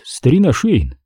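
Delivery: ordinary delivery, nothing marked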